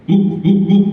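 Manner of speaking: short mock barks; dog barking. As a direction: bark like a big dog. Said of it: "Dia gonggong".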